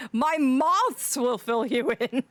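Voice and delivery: normal talking voice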